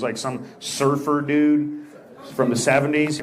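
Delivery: normal talking voice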